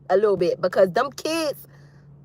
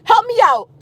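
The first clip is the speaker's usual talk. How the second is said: high, loud call for help; loud and high, calling the parents in to help; 8 semitones higher, 7 dB louder than usual. Retold or imitated. A single mom calling out to other parents, loud and high. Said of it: "that's why I was saying".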